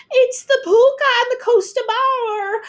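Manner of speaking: high loud cry; frightened shriek. A narrator's reading. Girl sees the horses rush past, frightened shriek. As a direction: shriek in fear. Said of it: "Ailish shrieked".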